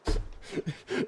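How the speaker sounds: soft, wheezy helpless laugh; real laugh. Real, not acted. Streamer cracking up at the screaming sheep, laughing. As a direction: laugh till the voice gives out.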